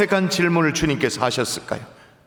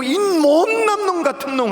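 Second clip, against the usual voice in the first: higher, slow mock scolding; a hint of a stern rebuke; 8 semitones higher than usual. Preacher imagining Jesus scolding Peter, mock stern.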